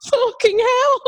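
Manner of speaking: high, loud shocked outburst; stunned disbelief. Retold or imitated. Rider reacting to instructor's admission, stunned disbelief. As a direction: blurt it out, pitch shooting up.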